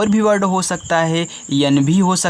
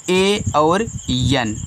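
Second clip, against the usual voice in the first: slow spelling; much slower than usual. Shown as a demonstration. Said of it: "spelling letters for pupils".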